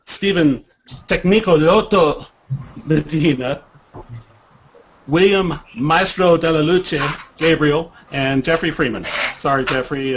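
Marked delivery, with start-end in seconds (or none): 0.1-3.6 hammed-up Italian accent on the name; terrible Italian accent. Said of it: "terrible Italian accents". 5.1-7.9 put-on hammy Italian accent on the nickname; terrible Italian accent. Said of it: "terrible Italian accents".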